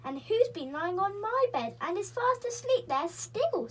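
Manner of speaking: high, loud little cub voice; baby bear voice. A narrator's reading. Baby bear finds someone asleep in his bed, high cub voice. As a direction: squeak it like a small excited cub. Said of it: "said the baby bear".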